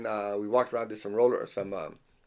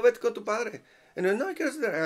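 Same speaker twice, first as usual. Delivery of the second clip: put-on high-pitched small boy insisting; mild child voice; 9 semitones higher, faster than usual. Son insisting to mom, light childish voice. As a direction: whine it a little like a kid.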